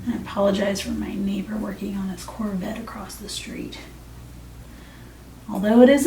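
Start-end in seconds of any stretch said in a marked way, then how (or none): 0.1-3.8 hushed aside; whispering. Real, not acted. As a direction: whisper it to the viewer. Whispered apology about noisy neighbor.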